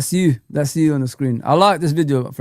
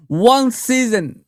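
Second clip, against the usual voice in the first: One season stretched slow and higher; bitter emphasis; 7 semitones higher, 3 dB louder, much slower than usual. Real, not acted. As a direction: lean hard on one season in disbelief. Lamenting the season destroyed.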